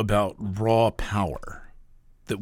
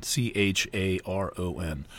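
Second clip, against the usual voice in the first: normal talking voice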